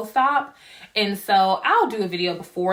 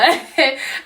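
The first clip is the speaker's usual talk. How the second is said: laugh; 7 semitones higher, 7 dB louder than usual. Real, not acted. Laugh at freaking out.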